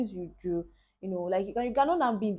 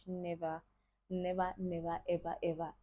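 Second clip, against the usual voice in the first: soft repeated never, never, never; emphatic nevers; 6 dB quieter than usual. Real, not acted. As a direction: stress every never quietly. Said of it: "I would never want".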